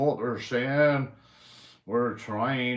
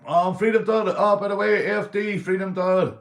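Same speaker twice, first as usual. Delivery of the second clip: higher and louder, hailing a fellow streamer; calling out; 8 semitones higher, 7 dB louder than usual. Real, not acted. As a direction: get his attention across the stream.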